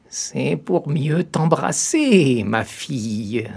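high, very slow sly granny voice; the disguised wolf reassuring the girl. A narrator's reading. Wolf answers as grandmother.

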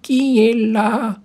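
put-on high, slow, loud old woman's voice; a frail granny calling from bed; old grandmother. A narrator's reading. Grandmother answers the door.

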